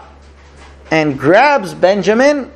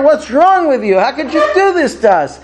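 higher, laughing reproach from the brothers; mock scolding with a laugh; 6 semitones higher than usual. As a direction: scold him half-laughing, like a fake beating. Brothers scold Benjamin while hitting.

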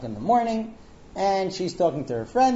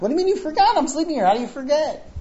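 high, loud indignant questioning; incredulous outburst; 11 semitones higher, 5 dB louder, faster than usual. Retold or imitated. Husband to wife who says she forgot, incredulous outburst. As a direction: fire back in exasperated disbelief.

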